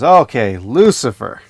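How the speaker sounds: lightly acted summons to the next culprit; God confronting Lucifer. God turns to Lucifer after excuses, mock stern.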